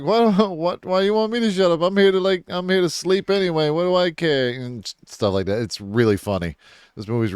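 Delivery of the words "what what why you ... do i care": high-pitched whiny goofy protest with a laugh; high mock protest. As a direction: squeak out an indignant defence. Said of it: "I'm like".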